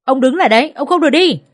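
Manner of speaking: high, loud desperate shout. Acted. Mother-in-law shouting after her husband as he walks out. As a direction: yell to stop him at the door. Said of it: "tiếng mẹ chồng tôi gào lên".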